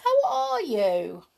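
high, slow, loud mock-friendly greeting; amiable. A narrator's reading. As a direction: draw it out with exaggerated pleasantness. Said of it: "in an amiable voice".

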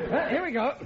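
laughing here we go. Acted. Repeated start with laugh.

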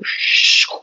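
long breathy "shhoo" sound; imitated whoosh. Girl settling in her lap, imitated whoosh. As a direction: let out an unvoiced whoosh.

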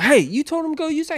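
high, fast, outraged question; indignant coworker. Retold or imitated. Coworker confronting the younger guy over the lift. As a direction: call him out in disbelief. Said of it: "he was like".

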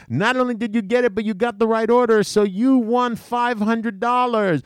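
imitating a gushing host; excited host. Host announces prize.

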